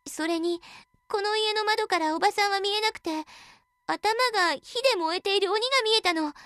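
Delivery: put-on high, frightened young girl; a scared girl blurting it out. Girl reports in fear.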